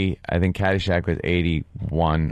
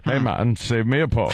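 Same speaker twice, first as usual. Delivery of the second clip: Doctor Beeper voice; 4 semitones higher than usual. Retold or imitated. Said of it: "she sounds like a female Doctor Beeper".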